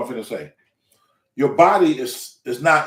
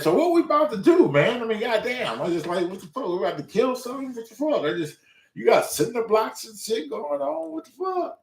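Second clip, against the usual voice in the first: higher-pitched, baffled retelling; incredulous; 8 semitones higher than usual. Retold or imitated. Newcomer questioning his trainer about the cinder blocks, incredulous. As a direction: play up the bewilderment. Alarmed reaction to the dungeon gym.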